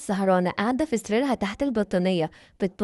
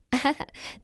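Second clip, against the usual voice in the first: short, soft acted chuckle; scheming laugh; 4 semitones higher, 5 dB quieter than usual. Schemer brushes off her nervous sister's doubt, scheming laugh. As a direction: chuckle quietly, dismissing the worry.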